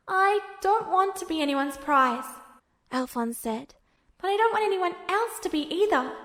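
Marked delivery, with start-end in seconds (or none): 0.1-2.3 put-on higher, earnest boyish voice; Alphonse's voice. Alphonse tells Edward his worry, higher boyish voice. 4.2-6.2 put-on higher, earnest boyish voice; Alphonse's voice. Alphonse tells Edward his worry, higher boyish voice.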